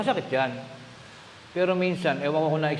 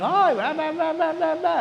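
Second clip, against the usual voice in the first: much higher mock yelling gossip from home province; mock shouting chatter; 12 semitones higher than usual. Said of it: "Walang nagsisigawan".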